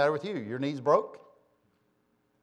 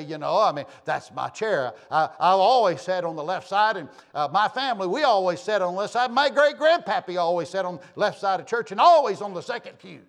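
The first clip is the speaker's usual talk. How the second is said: mock-huffy churchgoer, louder, higher; huffy and mad; 5 semitones higher, 8 dB louder than usual. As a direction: ham up a sulky member guarding his pew. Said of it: "get all huffy and mad and say".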